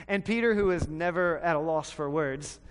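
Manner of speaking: ordinary delivery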